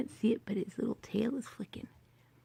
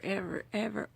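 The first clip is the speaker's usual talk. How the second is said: ordinary talk, nothing marked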